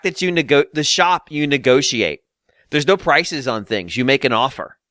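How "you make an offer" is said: usual speaking voice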